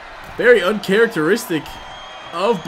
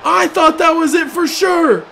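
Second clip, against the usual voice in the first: high, loud disbelieving yell; excited shout; 9 semitones higher, 5 dB louder than usual. Real, not acted. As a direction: scream it in disbelief. Kickout after third Claymore.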